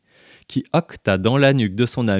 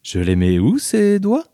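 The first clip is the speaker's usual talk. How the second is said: teasing insistence; 11 semitones higher than usual. A narrator's reading. Lorraine teasing Sophie with the question, rising higher. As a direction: tease her into answering. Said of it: "insista-t-elle".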